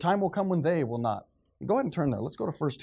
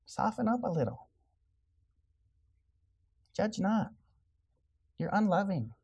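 sarcastic effeminate voice; 7 semitones higher than usual. Acted. Preacher imitating critics calling him unloving, sarcastic effeminate voice. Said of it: "using that effeminate voice".

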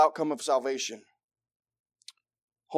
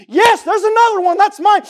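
high, exultant full shout; excited; 14 dB louder than usual. Acted. Preacher voices God claiming a living sacrifice, excited. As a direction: shout it out in triumphant delight. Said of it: "I think he gets excited".

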